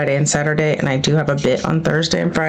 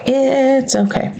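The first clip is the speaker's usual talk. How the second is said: It's okay said higher, slow and drawn out; high reassuring sing-song; 9 semitones higher, slower than usual. Acted. Vlogger soothing herself over crooked letters, high and slow. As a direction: talk yourself into accepting it.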